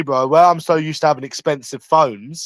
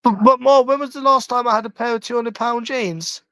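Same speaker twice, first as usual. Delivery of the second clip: higher, whiny pleading call; pleading with mum; 7 semitones higher than usual. Real, not acted. Son pleading with his mum about new jeans, high whiny call. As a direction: plead your case to mum from afar. Arguing with mum across house.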